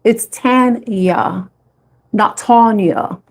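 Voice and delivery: "It's Tanya" drawn out slowly, "not Tonya" slow and firm; stress on Tanya. Shown as a demonstration. Setting people straight on her name, slow and firm. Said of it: "my name is not spelled that way".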